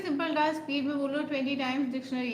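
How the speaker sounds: rattled off at speed; fast read. Shown as a demonstration. Reading the viewer's task out fast. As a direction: rush the whole sentence through.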